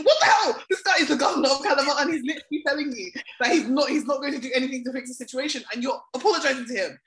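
high, loud, fast rant; indignant retold outburst; outraged disbelief. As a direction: burst out in exasperated disbelief, replaying your reaction. Acting out outrage at the reporters.

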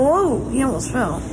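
ordinary delivery

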